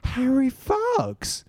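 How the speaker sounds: very high, stretched-out falsetto call; drawn-out, high-pitched mock female call; woman's voice. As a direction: imitate a woman calling out of nowhere. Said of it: "I hear this woman's voice go".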